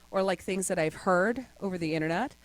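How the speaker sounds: ordinary talk, nothing marked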